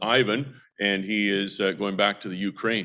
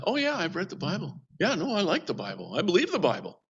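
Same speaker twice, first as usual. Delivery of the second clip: a lightly voiced young Indian cadet, higher and softer; imitating the young cadet; 8 semitones higher, 4 dB quieter than usual. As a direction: echo the cadet's soft, eager agreement. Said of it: "He's from Gujarat".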